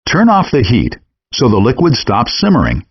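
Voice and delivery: normal talking voice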